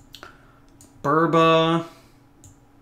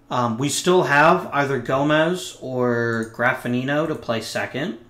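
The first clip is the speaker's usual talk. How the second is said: normal talking voice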